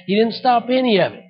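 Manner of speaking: ordinary talk, nothing marked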